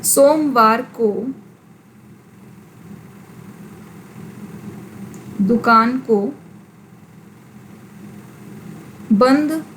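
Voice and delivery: slow dictation with very long pauses; word-by-word dictation. Shown as a demonstration. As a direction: dictate it piece by piece, halting long after each. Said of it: "अब यहाँ पर मैंने जो बोला".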